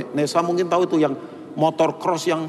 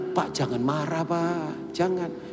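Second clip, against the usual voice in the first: soothing plea; 7 dB quieter than usual. Retold or imitated. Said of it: "saya bilang".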